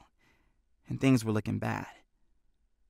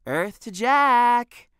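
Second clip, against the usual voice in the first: slow, louder, higher call; 11 semitones higher, 5 dB louder, much slower than usual. A narrator's reading. Benny calls out slowly to daydreaming Jack, louder and higher. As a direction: drag it out to snap him back.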